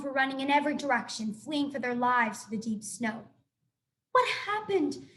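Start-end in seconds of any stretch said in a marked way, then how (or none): 4.1-5.1 urgent shouted question. A narrator's reading. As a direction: shout it over the chaos. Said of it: "shouted Roz".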